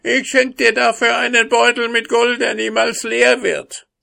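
sehr hohe, laute jammernde Zwergenstimme; erbärmliches Jammern. Acted. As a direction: flehen wie ein gepacktes Wesen. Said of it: "heulte und jammerte erbärmlich". (der Zwerg fleht den Husaren an, hoch und jammernd)

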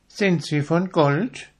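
high curious pin voice; pin's question. A narrator's reading. The pin asking its neighbour, high and curious. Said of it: "fragte die Stecknadel".

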